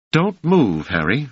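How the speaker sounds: put-on a young woman standing her ground; high voiced Doris. A narrator's reading. Doris commanding Harry to stay put, high and firm.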